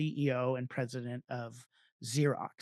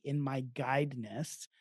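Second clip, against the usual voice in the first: normal talking voice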